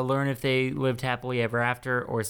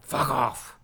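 Logan Roy. Retold or imitated. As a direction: toss off a curt TV-tyrant dismissal. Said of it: "that's my impression of that guy".

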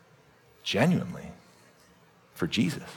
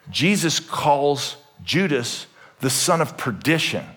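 normal talking voice

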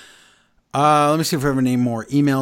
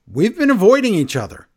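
imitating Gunther; 7 semitones higher, 6 dB louder than usual. Gunther's promo retold.

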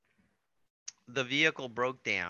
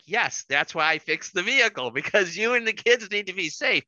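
exasperated insistence; 7 semitones higher, 7 dB louder than usual. Retold or imitated. Husband arguing back at wife.

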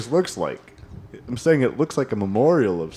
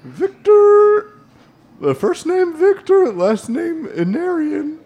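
high silly voice; 5 dB louder than usual. Acted. NPC voice.

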